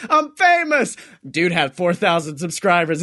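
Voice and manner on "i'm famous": smug boast. Retold or imitated. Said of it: "He goes".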